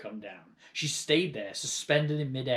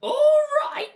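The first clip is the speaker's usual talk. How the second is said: very high, very loud indignant shout of all right; outraged yell; 13 dB louder than usual. A narrator's reading. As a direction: hurl all right back at him in disbelief. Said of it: "she yelled".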